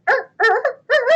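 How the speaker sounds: mock rooster crow, high pitched; playful cock-a-doodle imitation. Five is a rooster, reveal.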